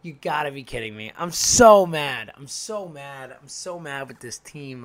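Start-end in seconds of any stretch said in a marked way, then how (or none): 1.2-2.3 louder, slower, fuming; mad outburst. Real, not acted. Fan venting at his collapsing team, loud and fuming. Repeats the line in two ways. 2.4-4.8 anger sinking into resignation; deflated. Real, not acted. Fan slumping after the outburst, soft and beaten. Repeats the line in two ways.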